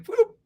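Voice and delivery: high, quick "boop"; playful high-pitched boop; imitated toss sound. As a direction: pop out a high little toss noise. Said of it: "You're just like".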